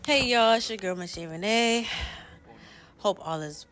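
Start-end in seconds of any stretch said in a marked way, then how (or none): none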